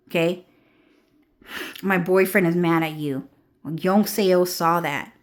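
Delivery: ordinary talk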